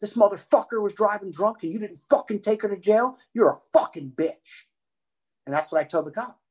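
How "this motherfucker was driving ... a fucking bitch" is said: higher, heated retold rant; mock furious dad; retold rant at cop. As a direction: tear into the cop. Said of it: "I'm like".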